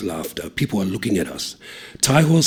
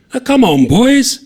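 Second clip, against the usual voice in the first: high, loud call; beckoning mates loudly; 11 dB louder, slower than usual. A narrator's reading. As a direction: call the boys from outside. Calling mates through window.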